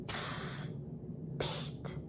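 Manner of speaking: hushed, breathy whisper. A narrator's reading. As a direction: call to him secretly so nobody hears. Sneaking up to the farm fence.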